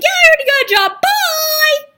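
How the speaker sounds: high, loud, fast mock yell, bye squeaked; brush-off. Hanging up on the scam employer, high fast mock shout. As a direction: shout it out quickly and hang up. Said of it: "I was like".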